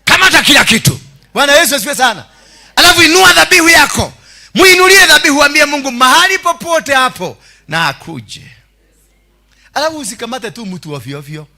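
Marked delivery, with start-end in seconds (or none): 0.1-8.5 high, loud shouted exhortation; full-force preaching. Real, not acted. Preacher telling congregation to bring offering and tell God, shouting high. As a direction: preach it in loud, driving bursts.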